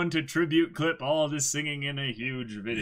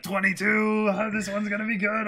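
high-pitched imitation of eager viewers; mock hyped fan; 7 semitones higher, faster than usual. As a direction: squeal it like an excited fan. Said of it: "So many people are like".

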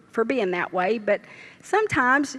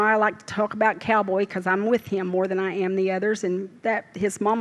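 usual speaking voice

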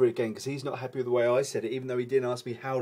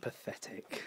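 whispered; 11 dB quieter, slower than usual. Real, not acted. Mocking Beverly's excuse.

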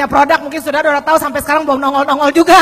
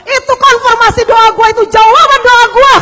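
higher and louder excited cry; retold shout; 7 semitones higher, 5 dB louder than usual. Ahok crying out that his prayer is answered, excited shout. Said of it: "Ahok langsung teriak".

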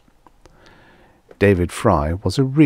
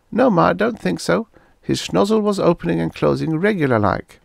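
put-on high, bright girl voice; young girl voice; 11 semitones higher than usual. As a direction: voice the daughter high. Daughter voiced in story.

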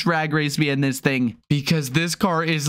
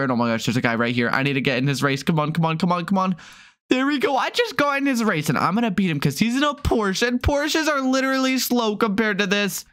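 ordinary talk, nothing marked

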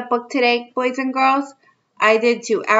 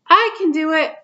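put-on higher, excited kid answer; little kid voice; 6 semitones higher than usual. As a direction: burst in like an excited child. Child voice in picture book.

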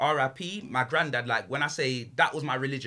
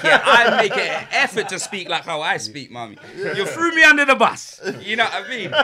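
laughing; 8 semitones higher, 9 dB louder than usual. Real, not acted. Teasing mum, laughing.

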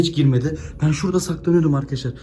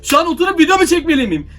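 higher, louder, frantic questions; real panic pouring out to viewers; panicked; 10 semitones higher, 6 dB louder than usual. Real, not acted. Vlogger panicking to his viewers, frantic and loud. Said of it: "Gerçekten panik yaptım".

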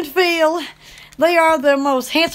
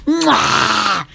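kiss sound; 8 semitones lower than usual. Real, not acted. Kiss to viewers.

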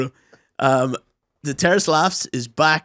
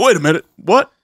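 loud, raised double take; mock confusion; 5 semitones higher, 8 dB louder than usual. Playing Goggins catching on, mock baffled. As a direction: stop short in disbelief. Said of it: "He's like".

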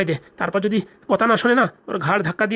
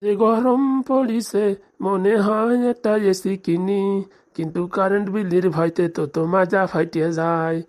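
slow musing; much slower than usual. Acted. Old father musing slowly alone.

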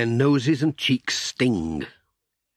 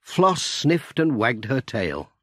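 usual speaking voice

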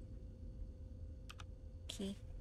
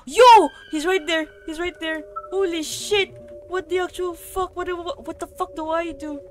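high, urgent cry of panic; real frightened yelling; panicked alarm; 6 semitones higher, 8 dB louder than usual. Real, not acted. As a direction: blurt it out in rising panic. Spots the monster right there.

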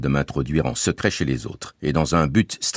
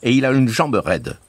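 put-on higher, lively voice for the boy Bouzig; Bouzig's voice; 9 semitones higher than usual. A narrator's reading. Bouzig reassuring the family, bright boyish voice. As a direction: play the cheerful young guide Bouzig.

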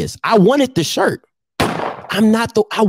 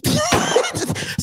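high laugh; 5 dB quieter than usual. Real, not acted.

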